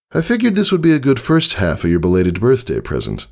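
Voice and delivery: breezy, a bit boastful; friendly older stallion. Acted. Tycho telling Scootaloo about learning it, casually.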